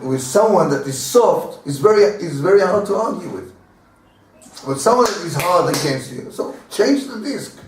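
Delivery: usual speaking voice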